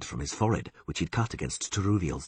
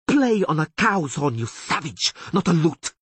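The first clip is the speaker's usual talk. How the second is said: much higher, louder contemptuous shout; furious shout; 11 semitones higher, 6 dB louder than usual. A narrator's reading. Taruviel shouting at Dandelion after smashing the lute, loud. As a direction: hurl the insult.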